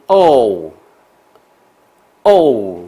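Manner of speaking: 哦 held loud, lips round, gliding to oo; exaggerated long O. Shown as a demonstration. Teacher showing the round-mouth long O, loud and drawn out. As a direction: stretch 哦 out big and round into an oo. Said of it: "比较夸张的口型".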